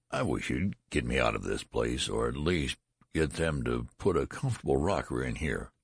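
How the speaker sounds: ordinary delivery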